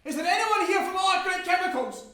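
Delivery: high, very loud desperate call; shouting over panic. Acted. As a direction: call out over roaring flames and chaos. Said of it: "I'm shouting over the panic".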